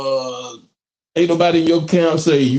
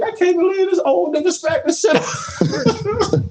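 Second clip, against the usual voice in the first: high, laughing disbelief; laughing shocked. Retold or imitated. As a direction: squeal it through laughter. Imitating shocked opponent.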